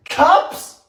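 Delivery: high rising disbelief; stunned, high-pitched query; incredulous question. Real, not acted. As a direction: ask it high, voice shooting upward. Disbelief at amount of sugar.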